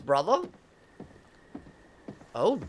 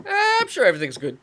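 airy, carefree mock of the characters, higher and louder; dismissive character voice; 8 semitones higher, 7 dB louder than usual. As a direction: brush it all off, cheerfully clueless. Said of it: "then they just go".

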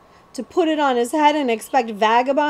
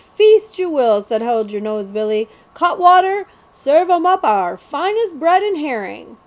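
louder, higher mock host; mock-grand outlaw; 6 semitones higher, 6 dB louder than usual. The outlaw mockingly orders a feast for the prince, loud and grand. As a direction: serve it up with a sneer.

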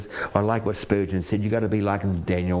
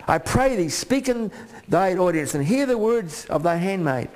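high, slow, fervent reading; preaching at full force; 10 semitones higher than usual. Acted. Preacher reading Abigail's plea, high fervent preaching. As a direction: lift the scripture up.

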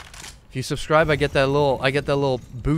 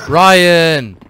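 loud, higher yell of Ryan; shouting a name; 4 semitones higher, 10 dB louder than usual. Real, not acted. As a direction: call him out sharply. Yelling at teammate.

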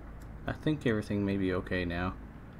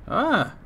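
high, loud sudden cry; startled gamer shriek; 12 semitones higher, 6 dB louder than usual. Real, not acted. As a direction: yelp in surprise. Sudden alarm in game.

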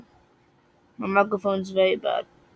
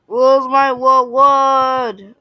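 slow, drawn-out demand; 5 semitones higher, 6 dB louder, much slower than usual. Pretend. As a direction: stretch out the demand. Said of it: "I am demanding a reward".

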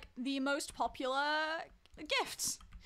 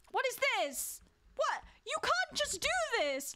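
high-pitched indignant scolding; playfully appalled, pitch jumping up; mock outrage; 9 semitones higher than usual. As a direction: tell them off in exaggerated disbelief. Mock telling-off over the basement.

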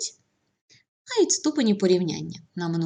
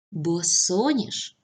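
босоніж drawn out, stressed on the second syllable, СО; bo-SO-nizh, careful dictation of босоніж; much slower than usual. Shown as a demonstration. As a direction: slowly say босоніж, leaning on the middle syllable СО. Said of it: "наголос на другому складі".

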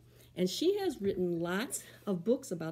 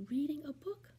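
whisper; 7 semitones higher, 5 dB quieter than usual. Acted. Teacher whispers rhyme intro.

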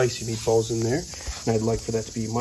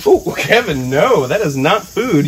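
scolding; 5 semitones higher, 7 dB louder, slower than usual. Real, not acted. Scolding snake striking at hook.

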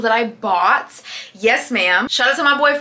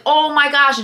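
retold amazement; 5 semitones higher, 5 dB louder than usual. Quotes own reaction to free gifts.

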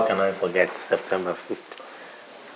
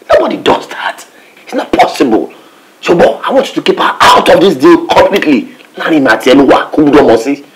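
loud, emphatic objection; heated insistence; 4 semitones higher, 7 dB louder than usual. Acted. Warning a partner to keep her out, heated insistence. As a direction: lay down the law, loudly. Heated argument over a deal.

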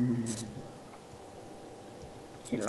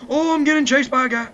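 high, loud panicked shout; panicked yell; 13 semitones higher, 9 dB louder than usual. Real, not acted. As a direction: yelp as if being chased. Panic while chased.

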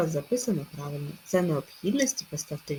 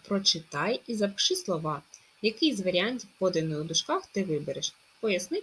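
ordinary talk

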